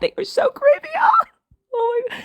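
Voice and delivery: a sudden high shriek of disgust; high-pitched shriek of revulsion. Real, not acted. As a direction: squeal it out, totally grossed out. Ranting about creepy men.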